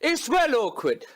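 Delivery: ordinary talk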